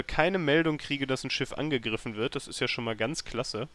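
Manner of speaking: usual speaking voice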